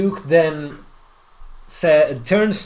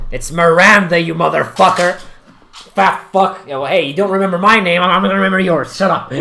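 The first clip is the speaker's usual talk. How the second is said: loud, raised-pitch indignant tirade; acted angry outburst; 4 semitones higher, 6 dB louder than usual. Elf lady whose name was forgotten, angry outburst. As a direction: play her loudly offended and cursing.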